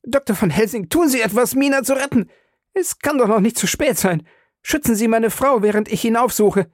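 high, pressing, desperate appeal; urgent plea. A narrator's reading. Husband urging the professor to protect his wife, frantic. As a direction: beg him to save her.

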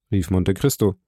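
plain reading voice

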